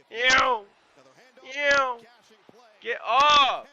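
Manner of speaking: loud, grossed-out ew, ew, get off; disgusted yelling. Real, not acted. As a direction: yell in real disgust at the screen. Reacting to a scary hit.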